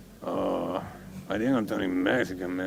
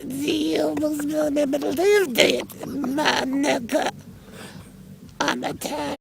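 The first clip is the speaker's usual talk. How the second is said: much higher, hammed-up tough-guy voice; mock T-Rex persona; 11 semitones higher than usual. Drunk mate hyping himself up as a Bronx DJ T-Rex, hammed-up high voice. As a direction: crank the pitch way up and play a swaggering Bronx DJ dinosaur.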